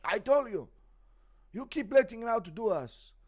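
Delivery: high, loud put-on fighter voice; Islam. Streamer as a fighter scolding his goat wife, high and loud. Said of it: "Impression on Islam beating his goat wife".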